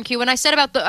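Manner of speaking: usual speaking voice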